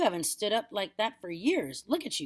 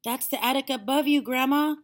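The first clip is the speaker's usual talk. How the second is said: put-on high, loud boy's warning; calling out; 8 semitones higher, 9 dB louder than usual. A narrator's reading. Boy warning grandma of attic, calling out. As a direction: call up to warn her. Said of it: "George called out".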